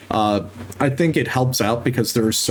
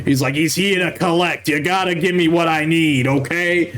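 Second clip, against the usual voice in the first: higher Jersey mob goblin, here to collect; New Jersey mobster; 5 semitones higher than usual. Retold or imitated. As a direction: lean in like a goblin collecting a debt. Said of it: "Okay, New Jersey mobster".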